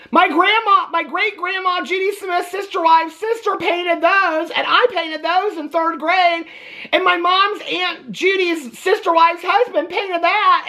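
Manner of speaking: drawn-out, high mock weeping; mock sobbing. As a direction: imitate a tearful wife wailing over keepsakes, slowly. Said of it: "sobbing and crying".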